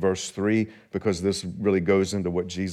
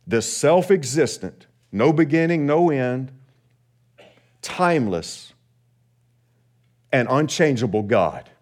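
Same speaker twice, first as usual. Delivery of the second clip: usual speaking voice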